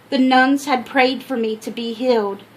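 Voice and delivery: ordinary delivery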